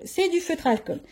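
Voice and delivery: "C'est" lifted high, then the rest falling; confirming it. Real, not acted. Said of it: "je crois que c'est du feutre à alcool".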